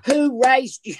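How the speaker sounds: scolding elder. Retold or imitated. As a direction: scold the kids like a Southern mother. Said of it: "they said".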